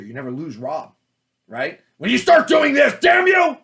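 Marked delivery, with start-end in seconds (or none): 2.0-3.6 hammed-up furious shout, much higher and louder; over-the-top mock rage; overacted anger. Shown as a demonstration. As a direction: fake angry yelling at the reader. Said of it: "then you lose the actor".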